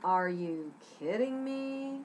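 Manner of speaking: slow, dry sarcasm; sarcastic disbelief, drawn out. Shown as a demonstration. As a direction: drag it out, unimpressed. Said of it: "Maybe you need it sarcastic".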